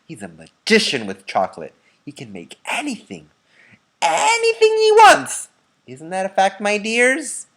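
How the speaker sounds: put-on an old man boasting to the others; loud excited elderly voice; excited Grandpa Joe. A narrator's reading.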